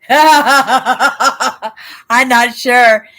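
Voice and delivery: loud laugh running into the words. Real, not acted. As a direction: burst out laughing, then admit it loudly. Amused by the odd word.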